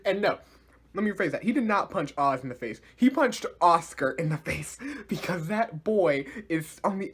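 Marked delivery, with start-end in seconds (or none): none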